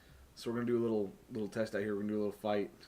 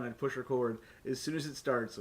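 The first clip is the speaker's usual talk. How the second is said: ordinary talk, nothing marked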